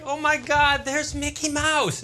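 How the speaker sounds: high, slow, starstruck gasp; thrilled tourist. Retold or imitated. Dad at Disney World imitating his own delight, high and slow. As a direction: gush like a wide-eyed fan. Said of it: "you're like".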